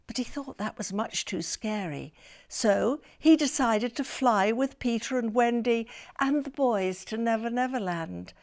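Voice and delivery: ordinary delivery, nothing marked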